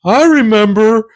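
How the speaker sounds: high-pitched imitation of a little kid; reminiscing kid. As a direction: put on a high child's voice. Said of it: "heard a kid go".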